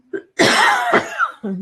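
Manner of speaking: real throat-clearing cough. Real, not acted. Medium feels her throat tightening mid-reading, coughs. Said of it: "So I'm gonna cough".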